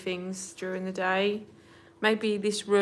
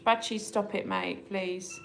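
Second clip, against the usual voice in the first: ordinary talk, nothing marked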